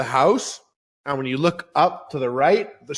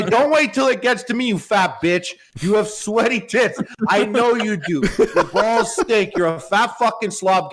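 normal talking voice

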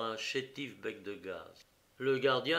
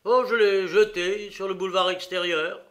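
higher and much louder; defiant answer; 7 semitones higher, 11 dB louder than usual. Acted. Prisoner answers the detective, loud defiant voice.

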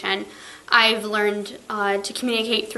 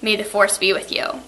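ordinary talk, nothing marked

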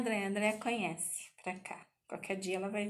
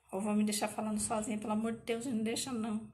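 rushed, slightly louder playful whine; fast mock complaint; 3 dB louder, faster than usual. Real, not acted. Teacher teasing silent students, fast mock whine. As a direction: hurry it out as a joking complaint.

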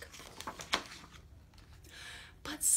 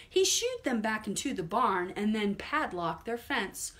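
ordinary delivery, nothing marked

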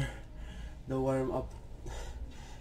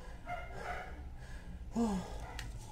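heavy sigh; 8 semitones higher, 11 dB quieter than usual. Real, not acted. Exhausted sigh during workout.

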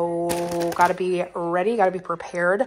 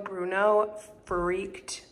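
usual speaking voice

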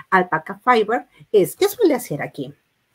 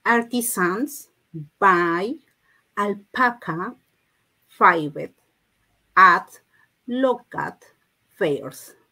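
slow, word by word with pauses; read-along model; much slower than usual. Shown as a demonstration. Teacher leading the class in reading English, slow and separated. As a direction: space out each word for learners to follow. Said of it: "Y lean conmigo".

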